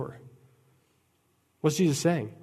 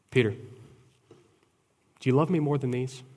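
slow, gentle, searching ask; Jesus asking Peter by the fire; tender question; much slower than usual. Acted.

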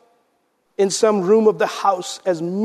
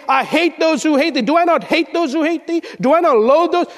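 high, intense psalm reading; fervent preaching cry; impassioned scripture reading; 7 semitones higher than usual. Acted. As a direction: declaim the psalmist's fury. Reads psalm's angry turn.

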